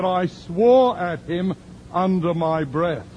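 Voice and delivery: lightly voiced ashamed woman; voicing the woman. The woman admitting she swore, high and slow.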